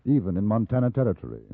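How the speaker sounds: low, measured storyteller's narration; story narration. A narrator's reading. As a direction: recount it like a memoir.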